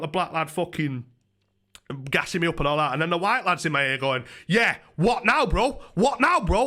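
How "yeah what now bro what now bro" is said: higher, louder taunting "what now, bro" in a put-on voice; mocking a mouthy lad. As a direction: mimic a gobby lad squaring up. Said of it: "Like that".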